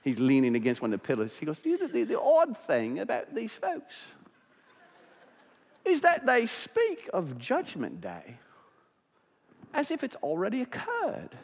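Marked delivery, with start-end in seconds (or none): 1.6-11.4 lightly done slow, high British sneer; a hint of the haughty British host; mild British accent, drawn out and superior; arrogant Briton. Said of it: "arrogant British man".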